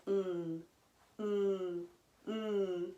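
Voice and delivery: "Mm" hummed soft and low with closed lips; m sound demo. Shown as a demonstration. Teacher models the letter M sound, softly hummed. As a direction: hum "Mm" gently for the kids. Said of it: "Missy Mouse says".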